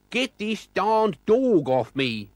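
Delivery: high, loud shriek in a strange voice. A narrator's reading. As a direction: screech it slowly as the dog attacks. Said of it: "shrieked McSneak".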